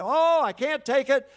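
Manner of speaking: high, loud and slow, mock despair; quitter's whine. Preacher mocking a quitter who moans that he can't take it. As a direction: play up a hammed-up quitter giving up.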